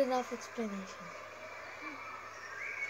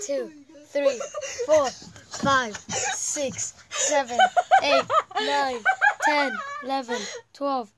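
counting through giggles, pitch jumping; laughing while tickled, squeaky; being tickled; 5 semitones higher, 6 dB louder than usual. Real, not acted. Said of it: "Please don't tickle me hard".

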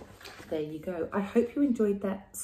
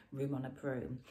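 usual speaking voice

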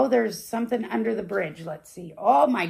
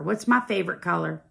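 plain reading voice

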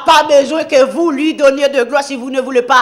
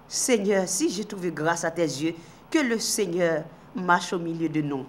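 humble prayer; 9 semitones lower, 12 dB quieter than usual. Acted. Moses prays humbly to God.

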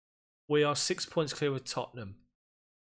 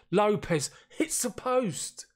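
slow, higher cry of disbelief as it hits the post; anguished commentary; 7 semitones higher, 3 dB louder, slower than usual. Real, not acted. Manager watching his striker hit the post, agonised. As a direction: drag it out in agony.

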